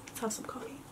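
whispered aside to herself; whispering. Real, not acted. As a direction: whisper it under the breath. Muttered aside, unvoiced.

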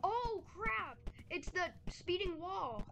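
sudden high yelp of alarm; real startled cry. Real, not acted. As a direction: jump at the danger. Sudden danger in game.

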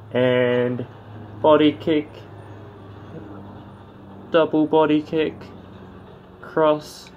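high, punchy strike calls; boxing ring announcer. Acted. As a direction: call each strike like a ringside announcer. Calling strikes as they land.

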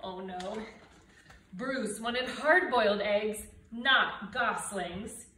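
usual speaking voice

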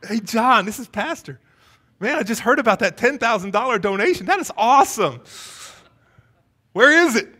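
high, loud pastor on the phone; gush, then loudly demand the money; hammed-up excitement. Imagined pastor's call to donor.

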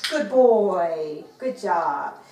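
loud, drawn-out praise with a fall; hearty praise. Real, not acted. Trainer rewarding her dog for a shake, hearty praise.

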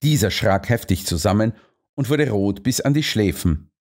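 ordinary delivery

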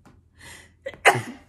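loud throat-clearing cough; sudden hard cough. Real, not acted. Reader breaks off between chapters, coughing.

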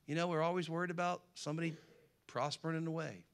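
ordinary talk

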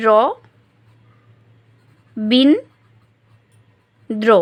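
"র বিন দ্র" with long pauses between syllables. Shown as a demonstration. Teacher demonstrating one-breath units, "র বিন দ্র" slowly syllable by syllable. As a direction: isolate "র", "বিন", "দ্র", each as one beat. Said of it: "আমি একবারে উচ্চারণ করতে পারছি".

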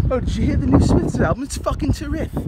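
high, loud mock English accent; fake English twang. Punk mocking trendy Utah kids, fake English twang. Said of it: "with a little bit of English twang".

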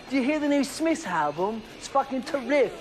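affected English twang; mock English twang. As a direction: parody a trendy fake-English speaker. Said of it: "that stupid little English twang".

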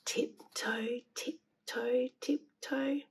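breathy whispered tiptoe; whispering. A narrator's reading. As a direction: whisper it like sneaking. Sneaking into the cave.

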